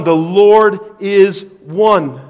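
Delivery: "one" given the weight, slowly; stressed one, slow. Shown as a demonstration. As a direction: draw it out and land on "one". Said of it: "the words one".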